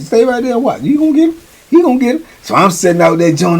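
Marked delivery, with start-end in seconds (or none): 0.0-2.2 much higher, eager; excited celly. Retold or imitated. Celly telling the teller to stay and watch, eager. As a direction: hype it up in an undertone. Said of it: "He said".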